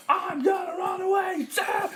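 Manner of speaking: high, loud, slow rooster squawk; shouting cockerel. A narrator's reading. Cockerel announces escape, high shouting squawk. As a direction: crow each word at full volume. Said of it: "said the cockerel".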